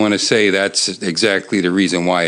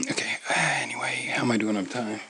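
hushed aside; whisper; 11 dB quieter, faster than usual. Real, not acted. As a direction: whisper it quickly.

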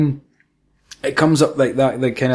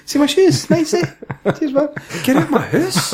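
much higher, cheeky put-on voice throughout; mock intruder voice; 13 semitones higher than usual. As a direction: play a brazen guest showing off. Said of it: "pretend intruder scene".

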